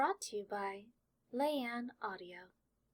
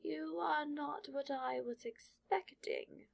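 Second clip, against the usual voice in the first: slow, higher, ancient hat's voice; sorting hat voice; 6 semitones higher, slower than usual. Acted. Sorting hat speaks inside Draco's head, slow and curious. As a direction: muse slowly, as if weighing him up. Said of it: "a voice suddenly floods his head".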